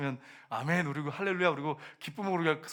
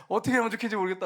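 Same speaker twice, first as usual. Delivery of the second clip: raised, high-pitched imitation of worrying; mock fretting; 6 semitones higher, 6 dB louder, faster than usual. Preacher mimicking worriers phoning around, high and fretful. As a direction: play someone loudly fretting on the phone. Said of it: "문제가 생기면 막 염려하고".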